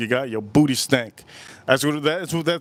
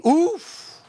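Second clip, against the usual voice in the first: high oof; 14 semitones higher than usual. Acted. Reacting to Haitian musk.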